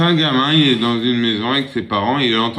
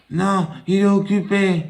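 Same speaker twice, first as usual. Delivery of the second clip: put-on high little boy voice; 8 semitones higher than usual. Small boy telling caller everyone is busy, high child voice. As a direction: answer like a small child, matter-of-fact.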